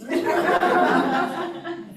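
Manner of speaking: loud high burst of laughter. Real, not acted. Teacher recalling a retreat insight, bursting into laughter. Realizing at the meditation bell.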